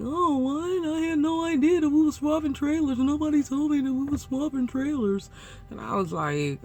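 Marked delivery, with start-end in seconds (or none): 0.0-5.3 high mock voice for the other driver; put-on driver voice. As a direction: imitate him in a high, bewildered whine. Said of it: "using that voice for his voice".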